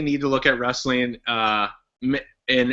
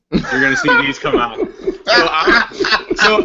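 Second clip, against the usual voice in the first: laughing; 9 semitones higher, 4 dB louder, slower than usual. Real, not acted. Puts on sunglasses, laughing.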